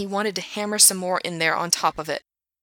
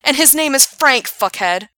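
angry yell; 7 semitones higher, 9 dB louder than usual. Acted. Gerard yelling at Asshat.

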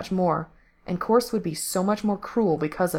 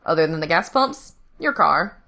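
louder, mocking sneer; 4 dB louder than usual. A narrator's reading. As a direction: throw it back with contempt. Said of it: "Frank sneered".

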